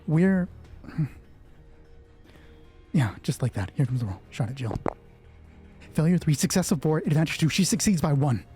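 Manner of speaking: ordinary talk, nothing marked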